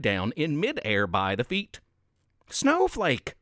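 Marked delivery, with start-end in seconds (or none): 2.5-3.3 mild mock scream. A narrator's reading. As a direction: call out in understated alarm. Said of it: "Jingle screamed".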